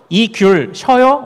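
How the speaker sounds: slow, careful Seoul Korean question; standard Seoul speech. Shown as a demonstration. Pastor modelling how Seoulites ask if tangerines are sour, slow Seoul speech. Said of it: "우리 이제 서울말".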